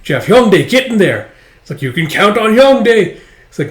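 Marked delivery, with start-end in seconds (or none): 0.3-1.3 high, loud battle command; shouted order. Acted. Soldier orders Hyungdae into the fight, shouted. 1.8-3.2 loud, high battle reply; shouted reply. Acted. Hyungdae answers the order in battle, shouted.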